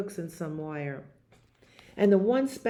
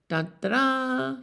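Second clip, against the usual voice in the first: sung high trumpet call; trumpet fanfare; 7 semitones higher than usual. Pretend. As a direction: play the trumpet with your voice. Imitating the Grinch's trumpet.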